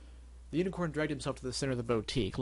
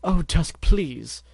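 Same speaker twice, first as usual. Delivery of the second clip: begging; 5 semitones higher, 8 dB louder, much slower than usual. Acted. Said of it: "collapsed begging".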